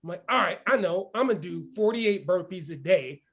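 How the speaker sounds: ordinary talk, nothing marked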